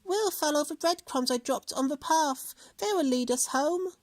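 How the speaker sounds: put-on child voice. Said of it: "Hansel said".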